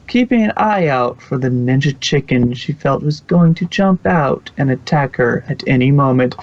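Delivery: ordinary delivery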